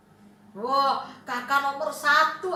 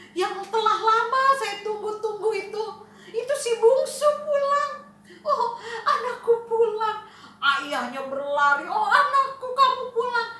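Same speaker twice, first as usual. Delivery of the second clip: higher, louder overjoyed father with laughs; joyful father; 5 semitones higher, 4 dB louder than usual. Acted. Father greeting his returning lost son, overjoyed. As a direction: cry out with delight at his son's return.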